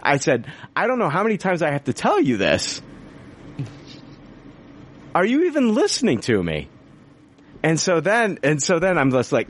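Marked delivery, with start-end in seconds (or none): 0.7-6.7 retold exasperation. Retold rant to support rep.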